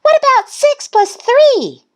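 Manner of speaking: very high, loud yell. A narrator's reading. Thistle beating Goose to the answer, high yell.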